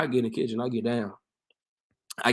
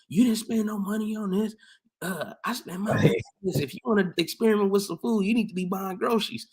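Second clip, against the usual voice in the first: much higher mom voice scolding her son; 11 semitones higher than usual. Retold or imitated. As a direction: scold him about wasting her money. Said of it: "she got mad".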